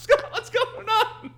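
high, slow, laughing hard. Real, not acted. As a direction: choke out words while laughing. Laughing can't get words out.

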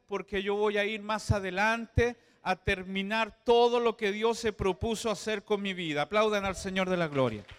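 loud building sermon shout; preaching at full force. Acted.

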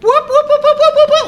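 high loud siren whoops; mock emergency siren whoops; alarm whoop imitation. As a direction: mimic a blaring alarm, laughing. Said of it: "we hear, like".

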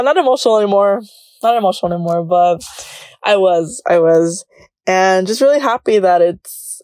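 usual speaking voice

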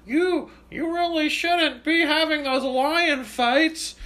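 high, slow, prissy mocking voice; mock a prissy critic, slowly; whiny mock scold. Mocking an imagined critic of Roman lion fights, high and slow.

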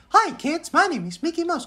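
very high cartoon falsetto; Mickey Mouse. Cartoon mouse greeting kids, very high falsetto. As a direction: squeak a cheery character greeting. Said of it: "Mickey Mouse kayak ngomongnya".

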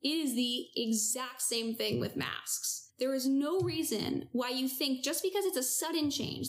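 usual speaking voice

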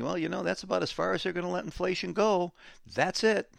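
high, fast mock voice of gullible people; mocking naive investors. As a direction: parody the know-it-alls. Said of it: "we got people saying".

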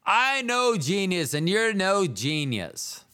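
hammed-up scolding, proclaimed for effect; mock chastising. Guest imagined chastising the host, mock grand rebuke. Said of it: "maybe he'll be like".

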